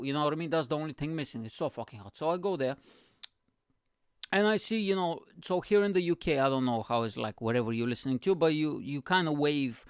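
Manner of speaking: normal talking voice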